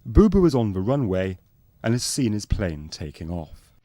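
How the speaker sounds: ordinary delivery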